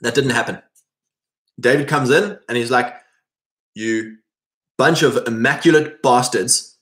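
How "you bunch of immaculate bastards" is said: ordinary talk, nothing marked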